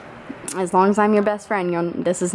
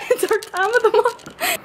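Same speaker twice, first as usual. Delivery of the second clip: high squealing laugh through words; 14 semitones higher than usual. Real, not acted. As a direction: crack up while saying it. Friend cracking up at joke.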